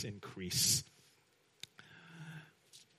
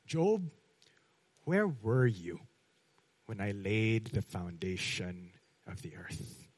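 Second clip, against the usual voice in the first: indicting voice of God; 6 semitones lower, 5 dB quieter than usual. Retold or imitated. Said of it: "he said these very indicting words".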